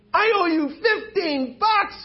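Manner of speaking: tearful begging. Acted.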